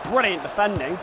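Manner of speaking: brisk football commentary call; live play-by-play. Acted. Gamer voicing commentary over his own match, play-by-play. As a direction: narrate the build-up like a TV commentator.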